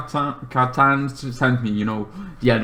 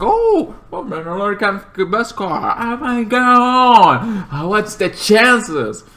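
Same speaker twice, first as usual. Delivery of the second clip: mock amazement; 9 semitones higher than usual. Mandalorian tests the spear.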